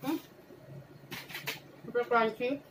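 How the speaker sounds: chewing while talking; muffled mid-bite speech; mouth full. Real, not acted. Seen 0: Bites the drumstick just before, then chews through the words.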